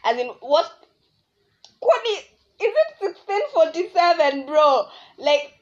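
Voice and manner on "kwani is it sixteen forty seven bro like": higher, laughing in disbelief; incredulous laugh. Real, not acted. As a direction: laugh at how absurd it is. Outraged disbelief at the guy.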